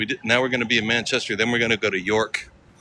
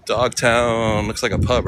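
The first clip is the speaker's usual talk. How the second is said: ordinary delivery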